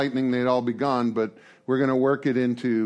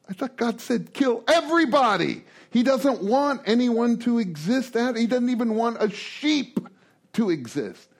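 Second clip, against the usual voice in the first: incredulous; 8 semitones higher than usual. Real, not acted. Incredulous aside about the order.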